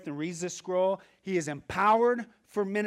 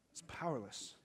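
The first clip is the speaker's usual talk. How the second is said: soft, low aside; quiet verdict; 5 semitones lower, 13 dB quieter than usual. Retold or imitated. Pastor privately judging his own sermon, softly. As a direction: drop it to a hush. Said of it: "I'm like".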